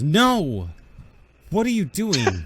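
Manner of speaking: alarmed shout. Acted.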